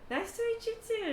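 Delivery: high-pitched, friendly greeting; eager greeting. Acted. Squadmate meets the general's wife, high eager greeting.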